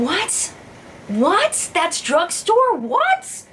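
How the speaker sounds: high, rising squeak of disbelief; can't believe the price. Real, not acted. Shocked a dupe is drugstore.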